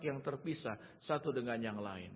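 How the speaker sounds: ordinary delivery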